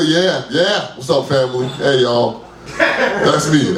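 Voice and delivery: ordinary talk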